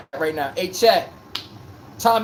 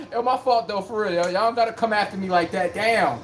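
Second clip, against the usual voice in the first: ordinary talk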